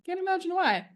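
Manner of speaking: high mock-innocent aside; sarcastic. Real, not acted. Joking about her period, high mock-innocent sarcasm. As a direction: wryly feign confusion. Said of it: "sarcastic punchline".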